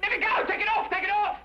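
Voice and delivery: high frantic yells; shouting. Acted. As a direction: yell in a panic to be let go. Struggling against the inspector.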